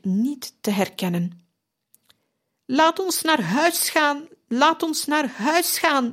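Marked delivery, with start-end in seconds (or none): 2.6-6.1 high, slow, pleading shriek; slow high delirious scream. A narrator's reading. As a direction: wail it out much higher and slowly. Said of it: "gilde hij weer".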